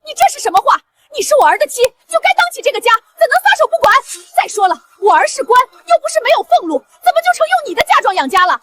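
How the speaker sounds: high-pitched, indignant tirade; matriarch's voice pitched high with anger; outraged scolding. Acted. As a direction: berate the daughter-in-law in outrage. Mother-in-law berates defiant daughter-in-law.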